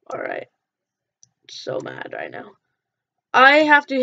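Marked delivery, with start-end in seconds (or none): none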